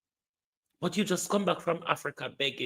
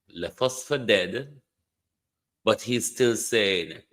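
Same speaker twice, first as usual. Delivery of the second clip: ordinary talk, nothing marked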